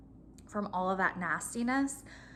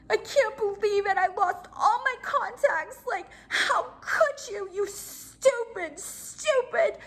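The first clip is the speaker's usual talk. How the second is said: much higher, mock-sobbing whine; whiny crying voice; 13 semitones higher, 5 dB louder, slower than usual. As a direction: wail it like a fake tearful tantrum. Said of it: "that same voice she was, like, using with her husband".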